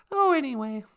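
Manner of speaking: ordinary delivery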